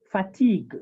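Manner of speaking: fatigue ending on the g, "fa-TEEG"; mute final E. Shown as a demonstration. Teacher removes the accent, fatigue with silent ending. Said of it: "the E is totally mute".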